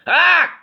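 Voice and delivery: very high, loud shriek of alarm; farmer's shriek. A narrator's reading. Farmer seeing a goblin, loud high shriek. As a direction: shriek in fright. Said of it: "shrieked the farmer".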